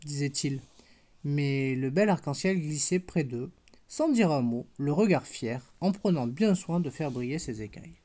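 put-on gentle storybook narration for a toddler; picture-book reading voice; bedtime story reading. A narrator's reading. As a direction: read it like a bedtime tale to a little girl. Reads bedtime story to child.

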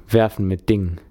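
ordinary delivery

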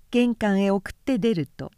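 plain reading voice

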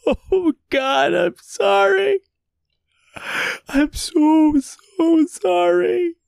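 high, tearful pleading; desperate weeping apology; sobbing. A narrator's reading. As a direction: beg through breaking sobs.

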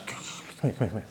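whispered come here; whispered aside. Acted. Peter pulling Jesus aside, whispering. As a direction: pull him close and whisper.

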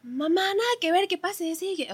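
high, loud and offhand, brushing her off; breezy talk to mom. Retold or imitated. Telling her mom not to worry, high and offhand. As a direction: toss it off to mom like it's nothing. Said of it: "yo le puedo decir".

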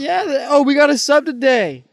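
loud, high cheer about a sub; excited shout. Real, not acted. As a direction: shout it out, thrilled.

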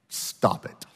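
a soft whisper; little whispered voice. Retold or imitated. Elderly lady rebuking the spirit, soft whisper. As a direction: whisper it, small but firm. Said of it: "I heard this little voice say".

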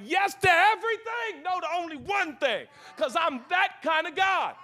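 high, loud voicing of God; God's generous proclamation. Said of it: "God was like".

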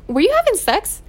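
mock the father asking high and nosy; imitating dad. Dad's question in retold story.

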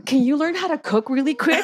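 high, laughing mock plea; exasperated plea. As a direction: beg her half-laughing to learn fast. Pleading with sister to cook.

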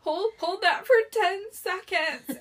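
high, slow, loud tearful wail; mock weeping. Weeping substitute coaching kids in P E, hammed up. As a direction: drag the instruction out through pretend sobs. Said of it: "I'm, like, weeping".

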